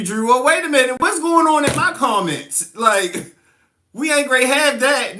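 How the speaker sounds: a sassy high-pitched character asking what's going on; a mock-outraged character voice checking her comments; bus route voice. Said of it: "Me do the bus route voice one time".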